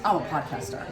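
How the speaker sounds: low, grave self-important announcement; dead serious. Retold or imitated. Mimicking a self-serious networking guy announcing his job. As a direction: play it heavy and solemn, like a big title. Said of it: "But he was so serious".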